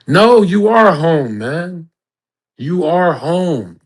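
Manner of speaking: high, loud and slow, hammering home the point; emphatic insistence. Real, not acted. Telling viewers they are already home, loudly insisting. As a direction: push the words out big and slow. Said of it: "insists to his viewers".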